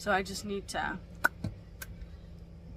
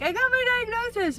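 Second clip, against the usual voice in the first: much higher, louder proud reveal; triumphant announcement; 13 semitones higher, 8 dB louder than usual. Retold or imitated. Imagined vlog ending, proud loud announcement. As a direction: announce it like big news to the camera. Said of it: "at the end of the day like".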